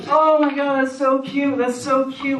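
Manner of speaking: put-on high gushing girly voice; gushing girl. Girl reading his text, gushing. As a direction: squeal over it. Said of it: "she's just like".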